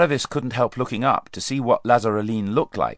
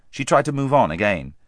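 plain reading voice

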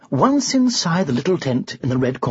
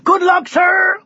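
loud, much higher cry; crowd cheering; 5 dB louder, slower than usual. Acted. The crowd cheers the underdog knight, loud cry. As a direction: call out encouragement. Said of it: "they cried".

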